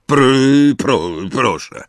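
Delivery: forced, halting please; grudging stammer. A narrator's reading. Uncle forcing out please with a wince. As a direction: choke out the polite word. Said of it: "krzywiąc się lekko".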